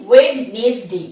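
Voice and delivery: ওয়েডনেসডে as wed-nes-day, D sounded; wrong pronunciation. Shown as a demonstration. Teacher reading out the written word, wrong pronunciation. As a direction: read ওয়েডনেসডে as spelt. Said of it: "but it is wrong".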